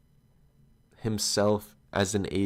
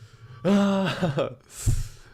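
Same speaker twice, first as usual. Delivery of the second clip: burst of laughter; 9 semitones higher, 5 dB louder than usual. Real, not acted. Laughing at the lobby story.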